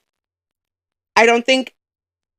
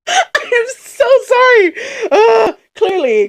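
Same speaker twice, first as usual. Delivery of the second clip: high crying laugh of apology; emotional, tearful; 12 semitones higher, 3 dB louder, slower than usual. Real, not acted. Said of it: "our emotions are all over the place".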